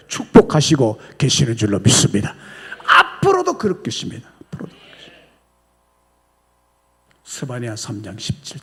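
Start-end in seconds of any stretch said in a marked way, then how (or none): 2.9-4.3 raised, louder proclamation; emphatic declaration. Real, not acted. Preacher repeats, then whispers. 4.3-5.2 soft breathy whisper; whispered echo. Real, not acted. Repeated as a whisper.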